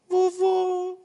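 put-on high sugary little-kid call; sweet child voice. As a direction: coo like a kid buttering up grandpa. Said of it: "o [name] muda até a voz".

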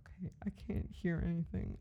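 hushed half-asleep whisper. Retold or imitated. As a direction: whisper it back in the dark. Boyfriend answers in the dark tent.

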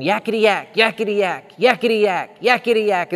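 high, loud mocking chatter; chattering monkey. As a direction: yap it like a pesky imp. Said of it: "this monkey kept going".

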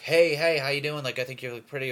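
high, eager chat-up opener; mock friendly come-on. Guy complimenting a passing girl, mock friendly come-on. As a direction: act out an overly polite pickup attempt. Acts out polite pickup attempt.

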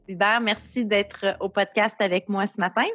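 ordinary talk

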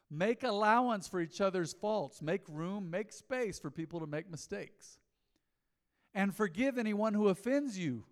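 measured preacher reading; scripture reading. Acted. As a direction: read the verse with weight.

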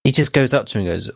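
ordinary delivery

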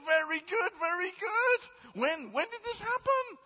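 very high, slow hammed-up praise; delighted Peter. Peter thrilled by the man's story, very high. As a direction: gush with exaggerated delight.

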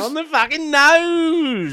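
slow, high, emphatic celebration; triumphant cheer. Real, not acted. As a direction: savor each word in triumph. Exuberant celebration.

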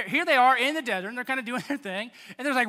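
ordinary talk

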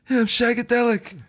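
high, far-off hammy spy voice; Austin Powers impression. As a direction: ham up the swinging sixties spy. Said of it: "find the Austin Powers music".

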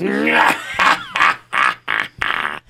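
deep slow evil chuckle; a gloating low laugh; sinister low chuckle; low villain laugh. Acted. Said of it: "the other kind of Russian".

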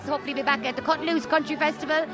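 ordinary talk

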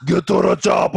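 put-on shouted Arnold movie line in an Austrian accent; Arnold Schwarzenegger impression. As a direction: bark it like an action hero in Austrian. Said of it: "part fucking Arnold Schwarzenegger".

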